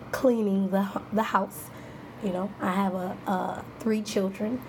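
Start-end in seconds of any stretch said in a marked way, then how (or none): none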